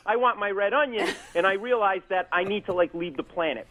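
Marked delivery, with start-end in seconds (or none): 0.1-1.1 a hint of a childish whine, higher; whiny demand. Hearing himself demand the onion, mild whine. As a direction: hear yourself whine for an onion. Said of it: "I hear myself going".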